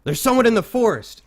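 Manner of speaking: high, loud shout of alarm; guard spots an intruder and cries out loud; guard's alarmed scream. Acted. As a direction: yell out a warning to the camp. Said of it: "And he screams".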